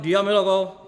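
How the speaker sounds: loud, curt shut up; angry snap. Retold or imitated. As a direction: bark it to end the talk. Husband snaps at wife.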